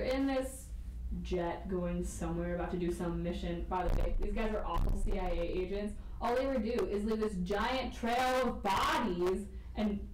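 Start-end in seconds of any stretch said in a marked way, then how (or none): none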